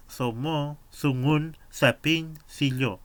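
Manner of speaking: Sungun and Sapin with a th-like s, said loud and clear; Mapuche s sound. Shown as a demonstration. Teacher modeling Mapudungun sounds for students, clear and loud. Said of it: "puede pasar a D".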